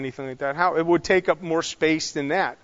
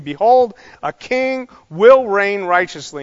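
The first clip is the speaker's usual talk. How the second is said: emphatic declaration; 3 semitones higher, 7 dB louder, slower than usual. Acted. Preacher quoting the prophet's announcement, emphatic declaration. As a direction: declare it with weight and raised voice. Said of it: "this is a very emphatic word".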